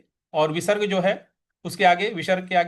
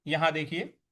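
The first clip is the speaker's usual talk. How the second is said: normal talking voice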